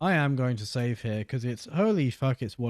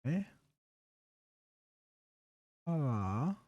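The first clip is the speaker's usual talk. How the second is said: talking around a finger in the mouth; muffled by fingers in mouth; 7 dB quieter than usual. Real, not acted. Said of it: "I'm talking with my finger in my mouth".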